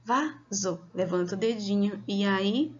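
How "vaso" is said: "Vaso" broken into Va and zo; two even beats on "Vaso"; syllable by syllable. Shown as a demonstration. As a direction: count the syllables of "Vaso" aloud. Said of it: "Aí você fala".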